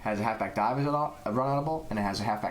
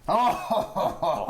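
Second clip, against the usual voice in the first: hammed-up evil ho-ho-ho, trailing out slowly; evil laugh. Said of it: "your evil ho, ho, ho laugh".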